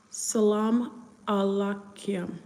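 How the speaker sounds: very slow, drawn-out greeting; careful sounding-out. Real, not acted. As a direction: sound it out word by word, slowly.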